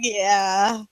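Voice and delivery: low, self-satisfied mock-cool voice; playing it cool. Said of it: "I felt so cool".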